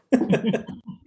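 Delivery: amused chuckle. Real, not acted. Storyteller after the minister's boiling punchline, amused chuckle. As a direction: chuckle at your own punchline. Teller laughs at his punchline.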